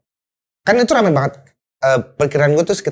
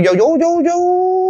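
very high, stretched-out words; drawn-out rising call; 13 semitones higher, much slower than usual. Retold or imitated. Survivor showing the sea dragging him away, stretched rising pitch. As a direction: draw each one out as it climbs away.

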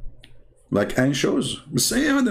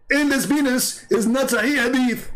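mocking commenter voice; 10 semitones higher than usual. Reading the commenter Mister Submission's reply aloud, mocking and high. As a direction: sneer his comment back in a higher voice. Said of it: "This is Mister Submission".

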